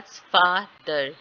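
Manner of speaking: "father" with a long, strong FA; teacher's drawn-out FAA-ther for "father"; stress on first syllable. Shown as a demonstration. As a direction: slowly stress FA in "father", then drop to ther. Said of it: "एफ के ऊपर stress आ रहा है".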